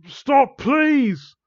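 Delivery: high, loud plea; begging. Acted. Victim begs villain to stop, loud pleading. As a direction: cry it out desperately, hammed-up. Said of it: "he begged".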